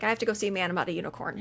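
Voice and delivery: fast, low mumble as he rushes off; hurried muttered excuse; rushed exit line. Retold or imitated. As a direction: blurt it while already leaving. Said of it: "He's like".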